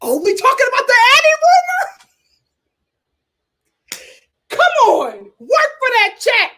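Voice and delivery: high, loud cheering at the screen; hyped cheering. Real, not acted. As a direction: egg the cast on. Hyped reactions to the show.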